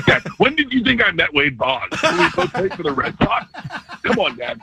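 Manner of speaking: mock disbelief, laughing it out; laughing at dad. Teasing dad in retold story.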